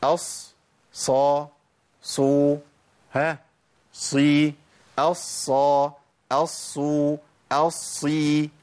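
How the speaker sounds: drawn-out, level sad syllables; demonstrated sad articulation. Shown as a demonstration. Teacher modelling the sad with each vowel, measured demonstration. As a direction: recite each sad syllable slowly and evenly. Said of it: "يبقى اقول ايه بقى".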